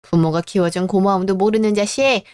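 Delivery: usual speaking voice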